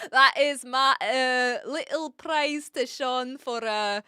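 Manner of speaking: slow, deliberate mock Scottish; put-on Scottish accent. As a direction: pick through it in amateur Scots. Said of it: "my best attempt at a Scottish accent".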